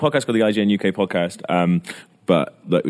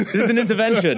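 laughing; 13 semitones higher than usual. Real, not acted. Mock interrogation, laughing.

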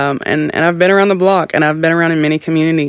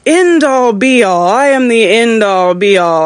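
grandiose mock proclamation, high and loud; hammed-up almighty dom; pompous god voice; 7 semitones higher, 5 dB louder, slower than usual. Mocking self-proclaimed godlike dom.

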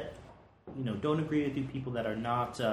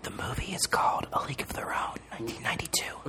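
hushed secret whisper; right in your ear; 5 dB quieter than usual. Pretend. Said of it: "I'll whisper in your ear".

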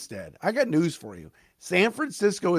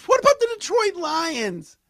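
excited; 12 semitones higher than usual. Real, not acted. Hyped podcaster rallying about Lions.

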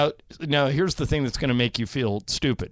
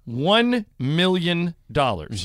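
slow dramatic offer; much slower than usual. Acted. Pitching the dare slowly.

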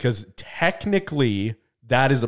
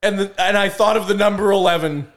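excited; 8 semitones higher, 3 dB louder than usual. Real, not acted. Boasting about guessing right.

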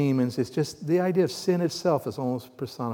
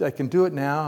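normal talking voice

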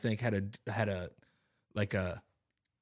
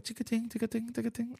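high, soft tick-a-ting jingle; jingling tags imitation; 11 semitones higher, 8 dB quieter than usual. Remembering the dog coming up the street, soft jingle imitation. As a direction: mimic tags jingling in the dark.

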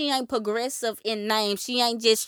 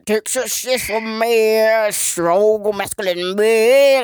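macho cowboy voice; 6 dB louder, much slower than usual. Retold or imitated. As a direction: sing it like a tough Texas cowboy. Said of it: "ultra masculine cowboy guy".

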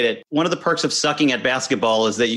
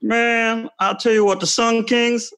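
Barkley impression; 10 semitones higher, slower than usual. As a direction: bellow it like Charles Barkley on TV. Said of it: "I can do Barkley".

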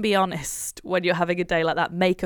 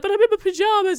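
higher, louder mock whine; mock whiny protest; 12 semitones higher, 7 dB louder, faster than usual. Mum retelling her protest to friend, high mock whine. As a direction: wail it like a helpless excuse.

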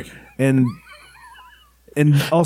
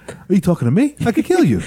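rising tough-guy Mark Wahlberg; Mark Wahlberg impression; 6 semitones higher than usual. Friend mocking Mark Wahlberg for a laugh, tough-guy voice. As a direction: push the Boston menace higher and higher. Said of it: "Mark Wahlberg was like".

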